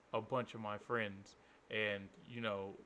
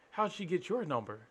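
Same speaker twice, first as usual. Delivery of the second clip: higher, louder puzzled question; the caller asks, confused and pitched up; 8 semitones higher, 4 dB louder than usual. Retold or imitated.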